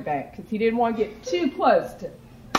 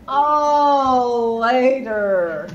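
put-on bored teen; 4 dB louder, much slower than usual. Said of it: "And she goes".

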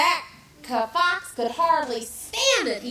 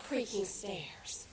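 soft unvoiced whisper; 4 semitones lower, 11 dB quieter, slower than usual. Real, not acted. Narrator whispers the story title again.

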